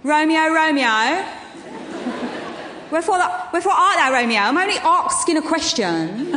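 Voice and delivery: hammed-up innocent Juliet pleading. Actress playing Lauren as Juliet calling for Romeo. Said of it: "hear that famous line coming out of her mouth".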